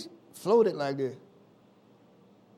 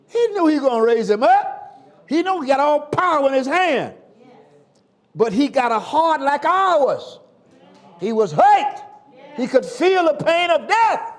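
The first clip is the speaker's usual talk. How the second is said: higher and louder, building to a shout; preaching at full force; 9 semitones higher, 8 dB louder than usual. Acted. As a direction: preach it hard to the church.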